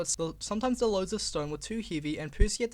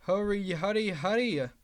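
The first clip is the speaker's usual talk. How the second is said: puffing; 2 semitones higher than usual. Acted. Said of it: "he puffed to the trucks".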